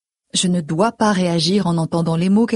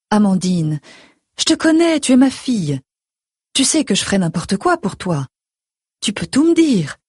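insistent mother; 3 semitones higher than usual. A narrator's reading. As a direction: urge your daughter to confide. Mother voiced, coaxing.

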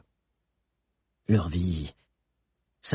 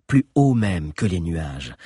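ordinary delivery, nothing marked

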